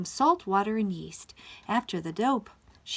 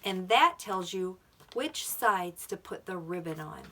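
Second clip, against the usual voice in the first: ordinary delivery, nothing marked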